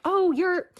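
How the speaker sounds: lightly mocked outrage; mock indignation. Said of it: "people get so mad".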